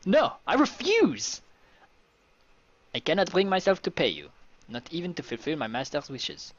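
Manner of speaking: high, hammed-up refusal; defiant valet. Valet refuses his master's order, theatrically defiant.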